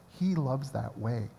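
ordinary talk

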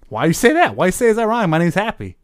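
loud, pushy Happy Gilmore bluster; a barbarian bristling at a stranger in a New York voice; angry New York tough guy. Acted. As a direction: get offended and loud about your name, Brooklyn style. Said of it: "kinda slipped into New York".

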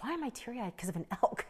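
quiet, hushed murmur to herself; half under her breath, moved by the elk; hushed aside; teary self-quote. Retold or imitated. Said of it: "I'm like".

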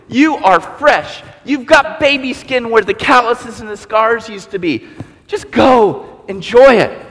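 high, bright exultant release; joyful Jesus. Acted. Jesus joyfully releasing the forgiven woman, high and exultant. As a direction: ring out a fresh start.

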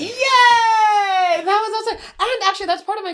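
high, loud delighted yay; excited cheer. Real, not acted. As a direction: squeal with joy. Cheering about dance routine.

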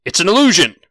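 loud, high shouted warning; yelling out. Acted. Knight warning the others about the beholder, yelling out. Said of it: "he yells out".

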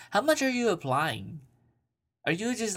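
high, pushy questioning; incredulous grilling. Real, not acted. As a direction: needle her with disbelief. Grilling the letter writer.

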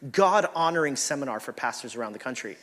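ordinary delivery

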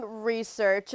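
"research" drawn out slowly, in audible air quotes; air-quoted "research". Real, not acted. Co-host doubting his motives, "research" in air quotes. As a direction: say "research" slowly and softly, as if in quotation marks. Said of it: "research in air quotes".

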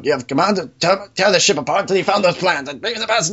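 high, mock-imperious Vader command; a lightly done Darth Vader delivery; Vader intonation. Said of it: "almost a, a Vader intonation".

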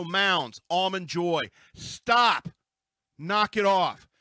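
loud exasperated scold. Real, not acted. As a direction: tell them off firmly. Scolding candy brands.

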